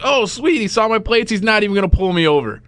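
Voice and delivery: hopeful excited. Retold or imitated. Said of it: "And I was like".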